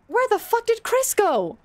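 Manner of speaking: high, incredulous exclamation; baffled outcry. Real, not acted. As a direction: exclaim it high in sheer disbelief. Searching for vanished game character.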